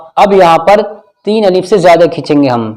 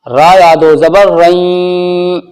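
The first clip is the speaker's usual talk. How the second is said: slow chanted spelling; recitation; much slower than usual. Shown as a demonstration. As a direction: intone each letter slowly. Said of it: "recitation demo".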